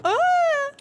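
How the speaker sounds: high retold shriek; imitated scream. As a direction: replay the scared yelp. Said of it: "I was still like".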